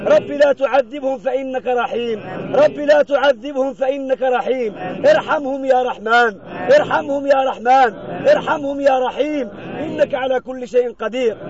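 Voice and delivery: chanted prayer with held syllables; intoned supplication. Acted. Imam leading mourners in pleas for the deceased, intoned supplication. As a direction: plead insistently in measured, level-toned phrases. Prays for those buried there.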